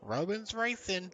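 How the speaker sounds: high, slow mock Texas drawl; Texas accent. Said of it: "a Texas impression".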